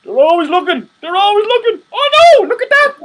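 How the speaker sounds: high, loud, rising to a squeal; mock paranoid alarm. Spooked that the camera cut out, high and loud. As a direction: play spooked conspiracy nut, pitch climbing.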